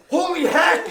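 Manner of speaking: loud, high laughing shout; shocked shout. Retold or imitated. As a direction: yell it in disgusted shock. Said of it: "he goes".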